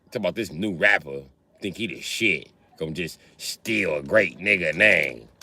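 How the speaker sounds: ordinary delivery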